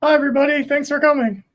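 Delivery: very high-pitched put-on character voice; loud, squeaky cartoonish greeting; Bubbles. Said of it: "we get the Bubbles voice".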